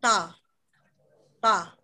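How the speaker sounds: TA with the tongue tip on the upper front teeth, close to a t; Korean d. Shown as a demonstration. Showing learners the Korean d, dental TA. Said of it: "D Korea itu beda pelafalannya".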